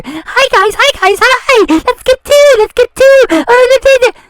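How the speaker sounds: high, chirpy mock vlogger voice; hammed-up cutesy YouTuber greeting; mocking her fake bubbly greeting. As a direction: parody her fake cheerful intro, way up high. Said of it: "her fake little character".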